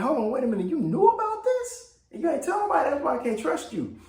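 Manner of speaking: very high, indignant disbelief; outraged husband finding out she knew; Jefferson, outraged. Retold or imitated. Jefferson confronting his wife over the secret, high and outraged. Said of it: "And Jefferson's like".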